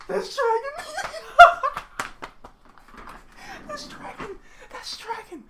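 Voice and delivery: very high squeal with a laugh; giddy disbelief. Real, not acted. Stunned by spotting Dragon.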